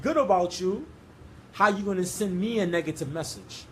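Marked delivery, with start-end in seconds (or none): none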